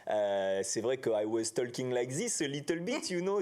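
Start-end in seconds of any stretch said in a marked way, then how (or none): 1.1-3.4 put-on English with a French accent. Actor showing the interviewer his early English, French accent. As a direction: speak English like a beginner from France. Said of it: "travaille sur mon accent pour plus avoir l'accent français".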